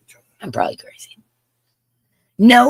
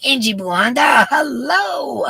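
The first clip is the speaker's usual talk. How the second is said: high, loud hailing; calling out; 9 semitones higher, 3 dB louder than usual. Real, not acted. Host saying hello to everybody, hailing one by name loudly. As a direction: holler the name, then lift the hello higher. Greeting a viewer.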